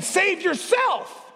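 high, drawn-out mocking shout; sneering taunt. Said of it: "They're sneering at".

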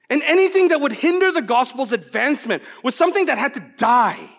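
high, loud, fervent sermon voice; impassioned preaching. Acted. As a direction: preach it with full conviction. Preaching at full force.

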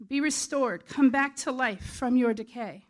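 ringing shout, summoning someone out; loud commanding shout. Acted. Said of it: "he shouted with a loud voice".

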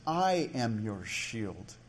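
"I am" drawn out with deliberate emphasis; stress on I AM. Retold or imitated. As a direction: lean slowly on "I am". Corrects what God didn't say.

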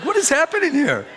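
high-pitched mock alarm; incredulous retold outburst; jealous bewilderment.

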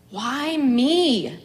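usual speaking voice

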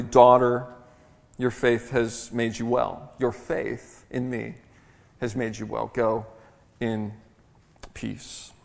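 soft, slow and tender, fading to a breath; tenderly. Acted. Jesus to the trembling woman, tender and soft. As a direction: gently reassure a frightened woman, almost whispering the end. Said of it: "He, he responds very tenderly".

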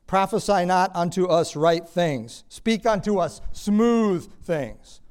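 loud, high, slow declamation; forceful drawn-out pulpit quote; acted demand. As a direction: demand it of the prophets, stretching each word. Acts the people ordering their prophets.

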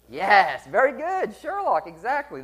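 high-pitched, delighted confirmation; excited praise. Real, not acted. Preacher congratulating a listener's correct guess, excited. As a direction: cheer the right answer, pitch leaping up.

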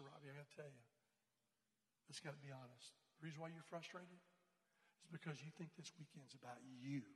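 low, very soft and measured; gentle confrontation. Retold or imitated. Leader telling Robbie the truth about himself, quietly frank. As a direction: break hard news quietly.

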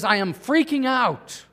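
hammed-up mild panic; mock freak-out. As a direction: play someone losing his cool. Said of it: "and he says".

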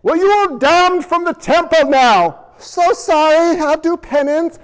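lightly voiced dialogue between elders and Moshe; acted temple scene. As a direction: play the stern elders and the sheepish Moshe. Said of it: "That's how they ran".

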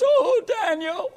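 high, slow, anguished cry of the name; lamenting cry. Acted. The king cries out to Daniel at the den, anguished. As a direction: wail it out in anguish. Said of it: "he cried out with a lamenting voice".

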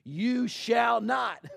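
high, loud and very slow, every word hammered; emphatic command. Acted. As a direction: lay down the law word by word. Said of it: "God comes out and says it".

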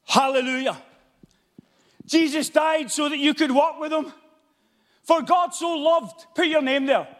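high, loud pulpit preaching with shouted peaks; fiery revival preaching; preaching at full force. Acted. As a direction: thunder it from the pulpit. Fiery sermon passage.

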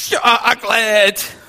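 high, loud, drawn-out crying word; sobbing toddler. Retold or imitated. Father imitating his crying son over ice cream. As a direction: wail it like a heartbroken two-year-old. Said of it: "He goes".

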